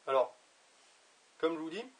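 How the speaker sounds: talking around pipe stem; pipe in mouth. Real, not acted. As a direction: lips gripping pipe. Seen 5: Pipe stem clearly held between lips throughout labelled words.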